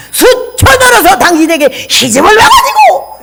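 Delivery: blurting a mock yell; retold shout. Rare fight with husband. (높게 웃으며 천천히 외치기)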